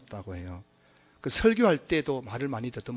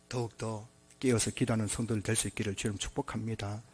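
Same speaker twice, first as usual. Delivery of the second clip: usual speaking voice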